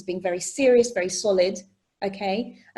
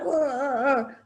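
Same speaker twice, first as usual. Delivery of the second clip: mock growling "arr" noises; imitated scary roar; 4 semitones higher, slower than usual. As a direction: mimic an intimidating speaker with wordless roars. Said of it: "She was so like".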